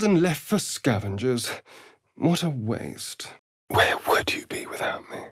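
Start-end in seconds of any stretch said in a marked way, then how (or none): 3.7-5.2 smug whisper; teasing whisper. Acted. Vampire teasing his companion, whispered smugly. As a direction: lean in and whisper it slyly.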